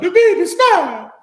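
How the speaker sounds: high, loud mock whine; mock crying whine. Teasing his partner about crying in the bathroom. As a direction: fake a tearful answer.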